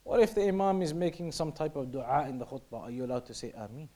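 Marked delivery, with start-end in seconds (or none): 3.5-3.9 soft, much quieter ameen with a rise; hushed ameen during the sermon. Shown as a demonstration. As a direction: murmur the ameen to yourself. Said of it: "you can say it quietly to yourself".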